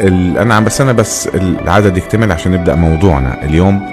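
ordinary talk, nothing marked

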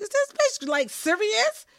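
can't believe she's serious; retelling her own stunned thought about a friend; shocked disbelief. Retold or imitated. Inner thought, shocked at challenge.

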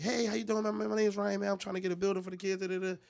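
high, chirpy, quick enthused greeting; enthused pitch. Retold or imitated. Fundraiser greeting the first car, enthused. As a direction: rattle off an eager sales pitch up high. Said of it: "You so enthused".

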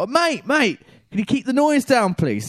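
high, loud call over a din; shouting over noise. Retold or imitated. Calling to the bin man over the engine, loud shouting. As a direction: yell to be heard above the engine.

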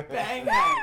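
laughing. Real, not acted. Laughing at the typo.

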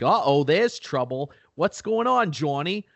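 put-on Boston townies hailing a pal, accent laid on thick. Friends greet Johnny as he rolls up, thick Boston accent. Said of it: "like, a thick Boston accent".

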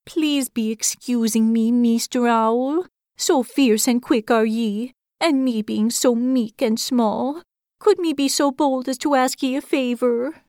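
a shy little hedgehog pleading slowly; timid hedgehog voice. A narrator's reading. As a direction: speak meekly into your own belly. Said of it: "spoke into his belly".

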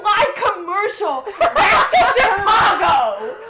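laughing shout over a book character's comeuppance; gleeful laughing shout. Real, not acted. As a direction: crow with delight at his comeuppance. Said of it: "I was thriving".

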